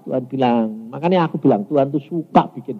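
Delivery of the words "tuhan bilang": ordinary delivery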